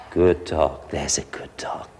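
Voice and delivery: low soft praise. Shown as a demonstration. Trainer praising the puppy softly. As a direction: reward the dog gently. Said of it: "So we say".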